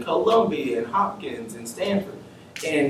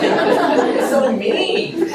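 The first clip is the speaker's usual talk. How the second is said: laughing disbelief; 7 dB louder, much slower than usual. Retold or imitated. Laughing at rude receptionist.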